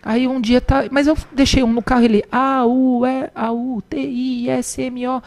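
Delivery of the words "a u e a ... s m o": letter by letter, then the word drawn out; slow beginner reader piecing letters together; spelling out. Retold or imitated. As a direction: imitate a child sounding out a word. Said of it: "Felipe começou a soletrar".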